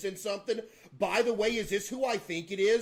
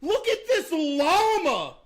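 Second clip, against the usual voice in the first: exasperated outburst; 10 semitones higher, 6 dB louder, slower than usual. Real, not acted. Reacting to movie trailer.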